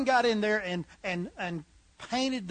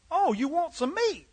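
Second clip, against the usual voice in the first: talking to a dog; 8 semitones higher than usual. Acted. Butcher talks to the dog.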